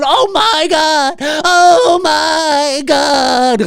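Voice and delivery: fans losing it. Retold or imitated. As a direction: stretch it out like a stunned screaming fan. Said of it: "Scream fans going".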